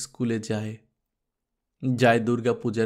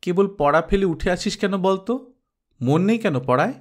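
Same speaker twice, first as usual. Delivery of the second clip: higher, firmer mother's reproach; mild scolding; 6 semitones higher than usual. Acted. Mother scolding daughter for leaving her studies, a mild raised reproach. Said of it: "মা বকত".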